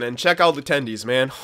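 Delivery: usual speaking voice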